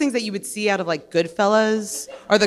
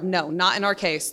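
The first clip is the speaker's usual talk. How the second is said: normal talking voice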